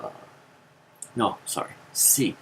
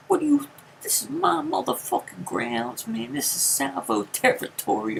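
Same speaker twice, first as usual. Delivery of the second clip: much higher, louder territorial rant; ranting uncle; 14 semitones higher, 3 dB louder than usual. Retold or imitated. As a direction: stake claim over his turf.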